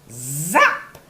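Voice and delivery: plain reading voice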